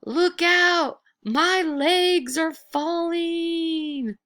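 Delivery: high, slow, drawn-out ghost wail; ghostly wail. Acted. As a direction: wail it down the chimney like a lost spirit. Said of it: "sad voice wailed down the chimney".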